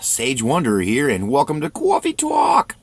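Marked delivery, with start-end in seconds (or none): 1.7-2.8 high, loud New York "cawfee tawk"; New York accent. Acted.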